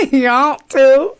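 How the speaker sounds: high, loud, slow mock drawl; mimicked Southern drawl. Teasing friend's drawl, mimicked Southern drawl. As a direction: echo it back as exaggerated Southern. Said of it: "He does the redneck".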